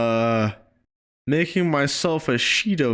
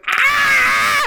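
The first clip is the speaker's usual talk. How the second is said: sudden high shout of alarm; high-pitched startled "Ah!"; startled yelp; 4 dB louder than usual. Acted. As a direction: yelp like you got shocked. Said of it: "I'm holding on to his hands and I'm like".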